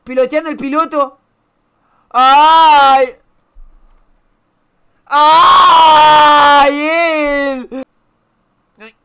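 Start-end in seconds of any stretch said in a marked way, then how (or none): 2.1-7.8 long loud shriek held steady. Real, not acted. Reacting to a video clip on stream, a long loud shriek. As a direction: shriek out in shock.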